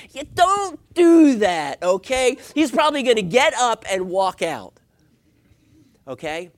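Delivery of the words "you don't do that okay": high, loud, drawn-out shout; emphatic warning. Real, not acted. Preacher warning wives off that prayer, loudly emphatic. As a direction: stretch each word out in loud reproof.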